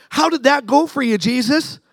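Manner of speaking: high, sing-out taunt; mocking. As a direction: needle him with a mock question. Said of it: "Mocking him".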